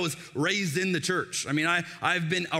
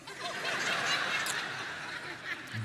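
high breathy laugh; 7 dB quieter than usual. Real, not acted. Laughs at son's joke.

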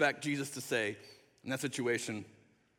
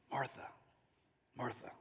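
very soft, low calling of a name; tender; 5 semitones lower, 14 dB quieter than usual. Acted. Jesus calming agitated Martha by name, tender. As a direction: soothe gently, leaving space between words. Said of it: "I love the tenderness".

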